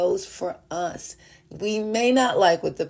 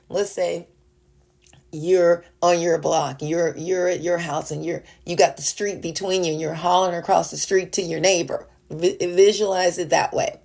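ordinary talk, nothing marked

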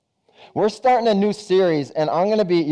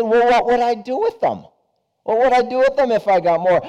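higher, puzzled voice, quickening; puzzled fisherman; 7 semitones higher than usual. Acted. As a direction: ask it like the idea is strange.

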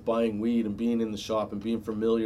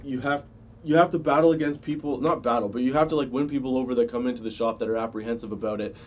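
ordinary talk

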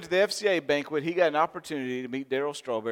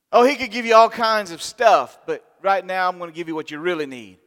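preaching emphatically; 3 semitones higher, 9 dB louder than usual. Real, not acted.

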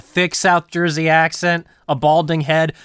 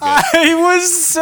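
laughing; 11 semitones higher, 3 dB louder, much slower than usual. Real, not acted. Laughing while talking.

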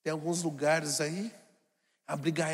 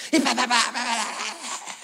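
mock scolding burst; 4 semitones higher, 6 dB louder, slower than usual. Mãe brigando, onomatopeia.

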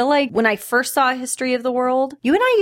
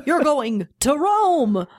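slow, higher, mystic seer voice; mock clairvoyant; 6 semitones higher, much slower than usual. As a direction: play the grand fortune-teller. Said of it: "and he's like".

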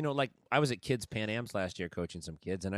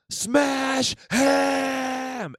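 high, full-volume scream of a command; very loud, high-pitched yelled imitation; imitated scream; 10 dB louder than usual. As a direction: scream it out like an out-of-control sideline parent. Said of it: "screaming louder than anyone can scream".

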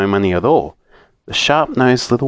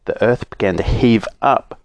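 usual speaking voice

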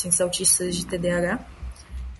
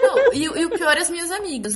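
laughing while talking, higher; 8 semitones higher, 4 dB louder than usual. Real, not acted. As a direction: laugh through the words. Laughing at co-host's joke.

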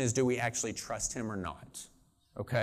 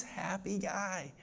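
ordinary talk

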